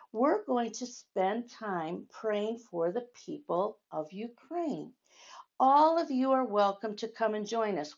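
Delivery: ordinary delivery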